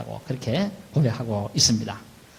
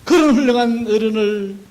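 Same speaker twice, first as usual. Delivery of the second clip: keen with regret, stretching the final words; acted tearful lament; 9 semitones higher, 6 dB louder than usual. Corinthians blaming themselves for misjudging Paul, acted loud tearful lament. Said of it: "이래 울었다 그 말이에요".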